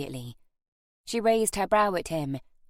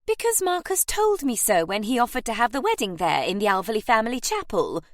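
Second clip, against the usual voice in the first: higher, emphatic great lady; insistent aunt; 5 semitones higher, 4 dB louder than usual. A narrator's reading. Aunt answering Harry's doubt, insistent. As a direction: press home the proof.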